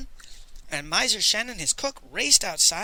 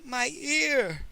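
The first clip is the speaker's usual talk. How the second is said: high, drawn-out whimper; 8 semitones higher than usual. A narrator's reading. The thug with the bandaged head, whimpering. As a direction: whimper it in a higher pitch. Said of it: "he kept whimpering".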